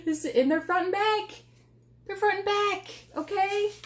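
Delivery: high, eager sales pitch; bubbling over about the board; excited. Real, not acted. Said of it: "I got excited there for a second".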